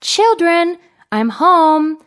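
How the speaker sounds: a sly wolf lightly faking a sweet mom; wolf faking mother. A narrator's reading. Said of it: "Trying to sound like their mother".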